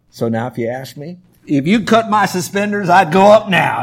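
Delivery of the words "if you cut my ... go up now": loud, higher, fervent outburst; exuberant declaration. Acted. Preacher adopting his professor's saying, loud and exuberant. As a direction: belt out the catchphrase with zeal. Now answers with the professor's catchphrase.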